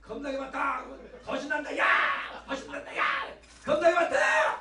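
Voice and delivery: high Jeolla dialect cheer, building to a shout; 전라도 사투리로 신나게 외치기. Shown as a demonstration. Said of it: "여기는 전라도니까".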